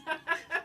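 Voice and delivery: quick real laugh at own bit; laughing at the joke; short laugh. Real, not acted. Comic laugh after impression.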